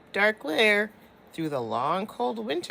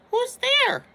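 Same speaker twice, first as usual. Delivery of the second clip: very high, louder squeaky call; mouse cry; 7 dB louder than usual. Acted. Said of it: "Mouse cries".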